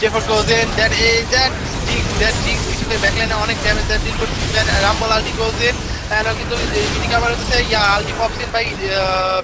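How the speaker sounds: rising excited play-by-play; esports caster. Acted. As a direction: call the team fight live, building up. Live play-by-play of team fight. (উত্তেজিত esports ধারাভাষ্য)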